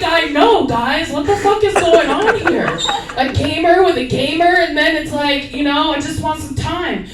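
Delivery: hammed-up pleading with her friends; mock exasperated shout. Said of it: "I'm like".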